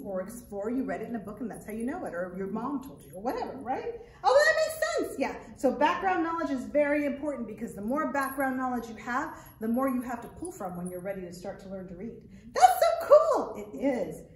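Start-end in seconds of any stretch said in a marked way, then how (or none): 4.2-5.1 high, loud excited puppet voice, partly sung. Acted. Puppet Arturo greeting the children, high excited voice. 12.5-13.5 high, loud excited puppet voice, partly sung. Acted. Puppet Arturo greeting the children, high excited voice.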